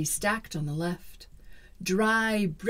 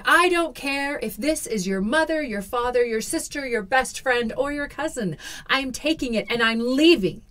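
loud defiant shout, higher; 6 semitones higher, 7 dB louder than usual. Acted. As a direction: yell it back fearlessly. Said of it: "I shouted".

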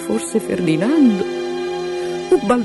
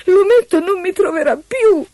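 high, despairing wail; singhiozzando; 4 dB louder than usual. Acted. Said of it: "singhiozzò Eloisa". (pianto disperato, voce acutissima)

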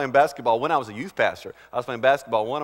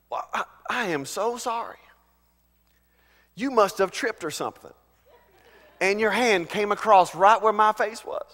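higher, halting, over-polite apology; mock-innocent apology; 6 semitones higher than usual. Preacher acts out excusing someone who slapped him, mock-innocent. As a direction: apologize with exaggerated understanding to the slapper. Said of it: "you say something like this".

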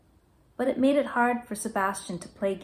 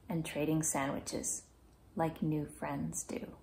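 soft ending; 2 semitones lower, 7 dB quieter than usual. A narrator's reading. Story winding down gently.

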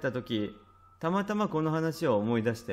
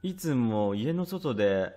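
ordinary delivery, nothing marked

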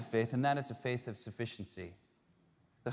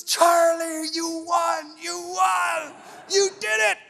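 much higher, loud joyful shouting; overjoyed Willy Wonka; 9 dB louder, slower than usual. Retold or imitated. Willy Wonka telling Charlie he won, in an overjoyed shout. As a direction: burst out with triumphant delight. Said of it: "he goes".

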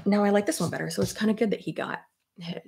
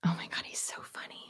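whisper; 13 dB quieter, faster than usual. Real, not acted. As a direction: breathe it out under the breath. Baby nearby, whispering.

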